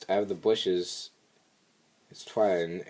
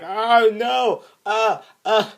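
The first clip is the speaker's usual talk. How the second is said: mock panicked squeal; very high, loud wail then little yelps; hammed-up cartoon fright; Fluttershy; 13 semitones higher, 10 dB louder, much slower than usual. Retold Fluttershy's panicked cry.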